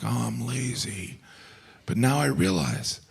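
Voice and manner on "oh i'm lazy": much softer, slow self-reproach; ashamed mutter. Retold or imitated. Napper scolding himself, soft shame. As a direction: mumble it in shame. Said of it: "I'm like".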